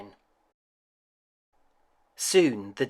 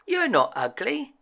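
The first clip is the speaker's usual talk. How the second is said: higher, kindly swan voice; 11 semitones higher than usual. A narrator's reading. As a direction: tell him gently he's beautiful. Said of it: "they said".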